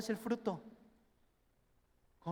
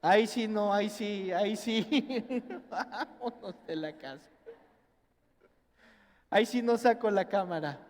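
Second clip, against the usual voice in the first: laughing dad; 4 dB louder than usual. Retold or imitated.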